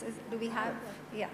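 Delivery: ordinary talk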